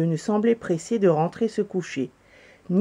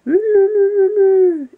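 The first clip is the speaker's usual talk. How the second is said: long, high, loud owl hoot; drawn-out ululating summons; owl call; 13 semitones higher, 9 dB louder, slower than usual. A narrator's reading. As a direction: hoot loudly to call the animals. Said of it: "Elle appela les animaux".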